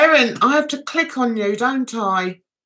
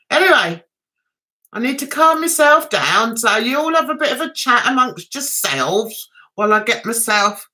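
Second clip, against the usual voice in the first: ordinary talk, nothing marked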